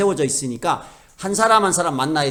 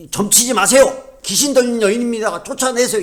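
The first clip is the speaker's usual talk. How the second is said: raised, high-pitched public warning; mock denunciation; 7 semitones higher, 3 dB louder than usual. What Paul did not cry at the fortune-teller, mock denunciation. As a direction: denounce her as if to a crowd. Acted denunciation, contrasted with avoiding quietly.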